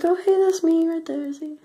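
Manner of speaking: high, loud cheery greeting to herself; playful silly voice. Pretend. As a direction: squeal at your reflection like a goofball. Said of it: "be like".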